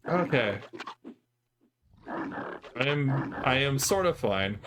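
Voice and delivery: normal talking voice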